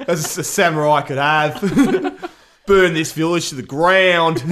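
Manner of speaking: hammed-up Aussie samurai, laughing through it; slow, drawn-out mock Australian warlord command. Host parodies the actor as samurai general, hammed-up Australian. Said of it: "you're an Australian samurai now".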